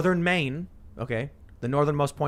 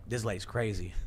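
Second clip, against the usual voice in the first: softer, dropped-down voice; quiet acted reaction; muttered aside; 5 semitones lower, 9 dB quieter than usual. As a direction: say it low-key, as a bystander's aside. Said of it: "they're gonna be like".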